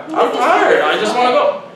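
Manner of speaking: high, loud mock plea; hammed-up worn-out complaint; exasperated protest. Acted protest to confrontational girl.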